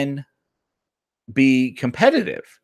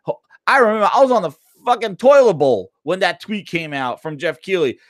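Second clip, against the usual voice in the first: ordinary delivery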